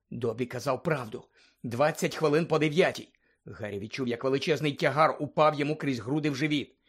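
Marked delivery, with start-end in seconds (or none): none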